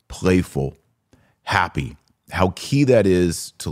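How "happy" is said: usual speaking voice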